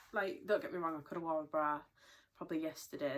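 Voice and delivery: normal talking voice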